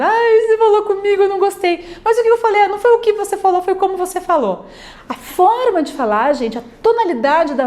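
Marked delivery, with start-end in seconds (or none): 0.0-2.0 higher, whiny hurt complaint; mock sulky lover complaining about tone; hurt partner complaining. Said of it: "Casais de namorado". 2.0-2.6 defensive partner. Retold or imitated. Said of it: "Casais de namorado". 2.6-4.7 offended retort. Retold or imitated. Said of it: "Casais de namorado".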